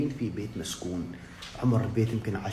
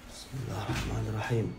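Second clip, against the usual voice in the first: usual speaking voice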